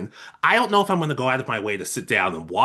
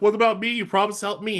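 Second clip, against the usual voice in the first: higher-pitched imitation of a put-out classmate; retold high-pitched protest; mock indignant plea; 8 semitones higher, faster than usual. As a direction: pipe up high, playing someone demanding their due. Voices classmate demanding promised help.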